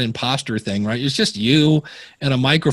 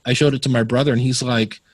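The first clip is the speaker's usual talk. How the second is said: ordinary delivery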